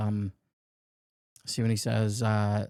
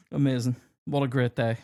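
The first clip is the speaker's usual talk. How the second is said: normal talking voice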